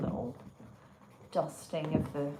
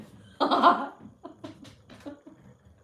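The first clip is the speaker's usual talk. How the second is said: big laugh; 8 semitones higher, 12 dB louder than usual. Real, not acted. Delighted by the chunky tree.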